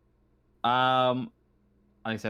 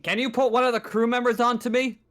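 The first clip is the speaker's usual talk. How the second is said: higher-pitched official request; station operator on comms; 9 semitones higher, 3 dB louder than usual. Acted. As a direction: ask over the radio, pitched up. Game master as station operator demands.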